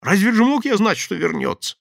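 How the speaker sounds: high loud excuses; anxious pleading. Acted. Thief makes excuses to the gang. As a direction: justify yourself, scared.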